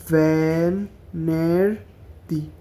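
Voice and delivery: very slow broken syllables; ভেনেরদি split into slow syllables. Shown as a demonstration. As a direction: say ভেনেরদি as ve-ner-di. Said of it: "এভাবে syllable ভাঙা ভাঙা".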